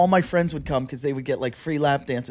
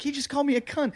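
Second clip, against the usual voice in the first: high-pitched mock scream, kept soft; 10 semitones higher, 5 dB quieter, faster than usual. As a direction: imitate an outraged woman's yell without the volume. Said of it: "while she's yelling and screaming".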